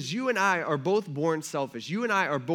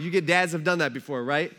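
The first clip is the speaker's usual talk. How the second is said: ordinary talk, nothing marked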